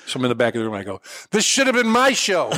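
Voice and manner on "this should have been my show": high, slow heckler's complaint; mock heckle yell. Comic replaying his planted heckle from the back, mock yelling. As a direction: heckle from the back, pitched up and drawn out. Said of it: "Just yell out".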